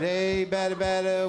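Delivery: ballplayer chatter. Retold or imitated. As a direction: chant it like a kid razzing the batter. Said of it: "maybe a little chatter".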